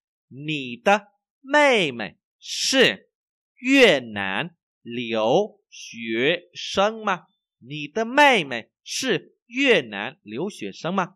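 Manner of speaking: very slow, loud, word by word; language teacher's model reading. Shown as a demonstration. Teacher reads for learners to repeat, slow model reading.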